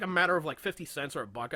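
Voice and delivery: usual speaking voice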